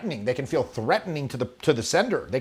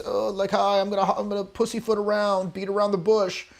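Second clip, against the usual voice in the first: higher, hesitant hedging voice; pussyfooting flirt; 6 semitones higher than usual. Retold or imitated. Coach mocks a man who won't own his intention, higher and hesitant. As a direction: tiptoe around like a nervous suitor. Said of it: "otherwise you're like this".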